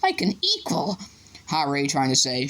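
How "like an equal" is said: higher, tearful elf voice. A narrator's reading. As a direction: elfo commosso, voce acuta. Elf in tears.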